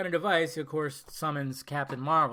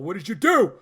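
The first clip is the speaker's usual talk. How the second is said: louder, building demand as Thor; raised acted cry; 2 semitones higher, 9 dB louder than usual. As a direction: call it out, not yet at full shout. Acted quote of stunned Thor.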